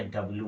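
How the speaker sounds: डब्ल्यू squashed to "dab-loo"; lazy two-syllable "dabloo" for डब्ल्यू; common mispronunciation. Shown as a demonstration. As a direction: drop the y from डब्ल्यू, ending in loo. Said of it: "डब्ल्यू मत पढ़ना".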